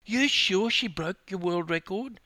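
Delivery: high-pitched incredulous question; disbelieving. A narrator's reading. Husband questioning his wife's lost record, disbelieving. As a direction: ask it again in high disbelief. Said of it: "said a disbelieving Robert".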